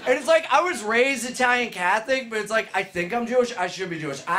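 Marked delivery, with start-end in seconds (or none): none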